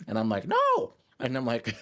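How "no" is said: high shouted no; shouting no. Pretend. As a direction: cry out no in protest. Protesting own brain.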